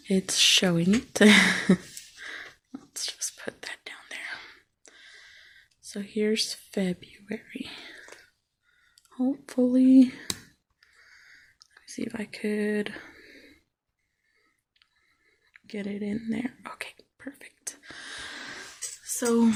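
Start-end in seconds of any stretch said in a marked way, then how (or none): 1.2-7.7 soft slow whisper; whispering so nobody wakes; quiet at night. Real, not acted. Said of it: "sorry if I'm talking really low". 10.0-17.8 slow hushed whisper; barely whispering at dawn; quiet at night. Real, not acted. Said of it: "sorry if I'm talking really low".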